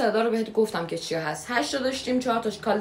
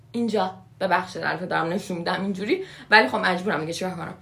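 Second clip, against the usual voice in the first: usual speaking voice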